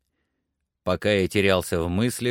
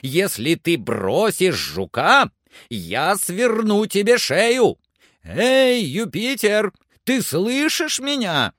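high, loud shouted warning; scolding threat; 10 semitones higher, 4 dB louder, slower than usual. A narrator's reading. Master threatens climber over the beetle, scolding shout. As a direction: berate him upward, ending in a high call.

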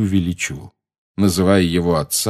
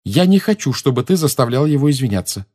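high-pitched indignant cry; raised voice, starting very high; shouting; 6 semitones higher than usual. A narrator's reading. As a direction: cry out in angry protest. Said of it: "закричала Лили".

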